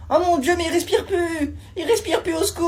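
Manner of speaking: high, loud panic; frantic high-pitched alarm; panicked cry for help. Acted. Panicked bystander calls for help.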